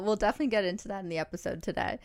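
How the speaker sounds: normal talking voice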